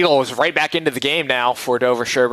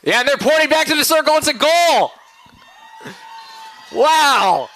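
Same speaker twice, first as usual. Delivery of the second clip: thrilled the goal counts; excited; 8 semitones higher than usual. Real, not acted. Disputed goal confirmed.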